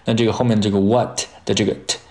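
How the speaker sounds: ordinary delivery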